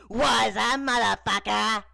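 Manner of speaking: loud, high rapper doll calling out; put-on rapper voice, shouted greeting. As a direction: call out high and loud in character. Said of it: "he called to Woody".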